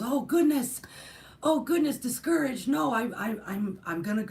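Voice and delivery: high, loud flustered alarm, easing as it goes; dismayed Clarence. Retold or imitated. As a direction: act out an angel's shocked dismay. Acts out Clarence's alarmed reply.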